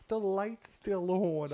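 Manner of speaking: high, slow, drawn-out whine; mock-pout about the light; lazy sleepy whine from bed; whiny partner complaint. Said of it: "She goes".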